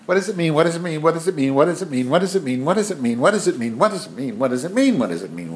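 high, nonstop nagging questions; pestering kid. Retold or imitated. Nephew demanding the meaning from the aunt, mock pestering. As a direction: mimic a child asking on a loop.

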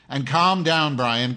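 calm down said loud and high, sarcastic; scolding a heckling viewer, loud and sarcastic. Real, not acted. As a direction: snap it back at the viewer.

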